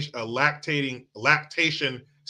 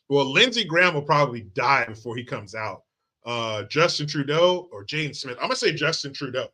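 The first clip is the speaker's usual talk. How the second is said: ordinary talk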